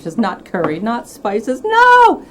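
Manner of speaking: playful outcry building to a high, loud "No!"; mock horrified protest. Host teasing hiding guest about curry and spices, mock horror. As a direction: build to a mock yell of refusal.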